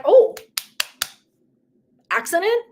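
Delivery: high, loud ironic "Oh, accident?"; mock surprise. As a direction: feign surprise, sarcastically. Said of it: "I'm like".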